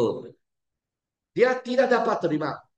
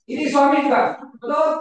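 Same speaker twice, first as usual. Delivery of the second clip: mock shouted retort; 7 semitones higher, 5 dB louder than usual.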